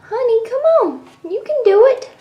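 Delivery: high, cheering coax. Real, not acted. Owner urges her cat to climb the stairs, high coaxing voice. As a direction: lift the voice to coax a pet up.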